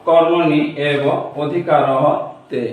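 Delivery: অধিকারতে drawn out very slowly after কর্মণি এব; correct recitation. Shown as a demonstration. Teacher giving students the corrected Sanskrit pronunciation slowly. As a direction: say কর্মণি এব অধিকারতে, stretching অধিকারতে syllable by syllable. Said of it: "তাহলে উচ্চারণটা কি আসলো?".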